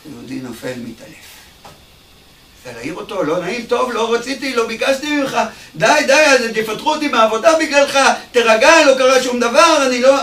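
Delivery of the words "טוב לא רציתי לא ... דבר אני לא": higher and louder, anxious pleading; mock panic. Bellhop begging the fainted guest to wake up, anxious. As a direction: plead in a hurry, a bit frightened.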